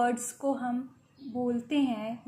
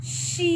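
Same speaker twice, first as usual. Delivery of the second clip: शी drawn out, higher on a long E; long vowel; 5 semitones higher than usual. Shown as a demonstration. Teacher reading the next example, long raised E in शी. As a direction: stretch the ई in शी. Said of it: "लंबा पढ़ रहे हैं".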